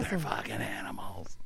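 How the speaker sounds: hushed, imitated magician's whisper; mock-wounded whisper; retold whisper. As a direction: whisper it as if crushed. Repeats the beaten magician's line.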